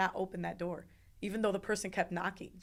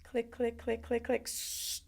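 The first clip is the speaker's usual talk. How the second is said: even, higher-pitched mechanical clicks with hissing suction; imitated machine clicking; 4 semitones higher, slower than usual. Patient recalling the instrument's sound, mimicked clicking. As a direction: tick out the clicks like a ratchet, then hiss. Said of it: "I just remember the sound".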